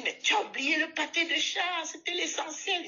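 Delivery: lightly voiced scolding old lady; mock scolding old woman. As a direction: play the cross old woman nagging.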